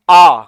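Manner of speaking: a loud, wide-open आ; open आ demo. Shown as a demonstration. Teacher showing the open vowel आ to students, loud and wide. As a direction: open the mouth fully on आ. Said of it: "आ बोलते समय मुंह क्या खुलता है? पूरा।".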